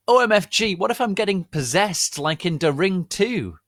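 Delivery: high, loud, frantic squeal; panicked. Acted. Ebony panicking about being possessed.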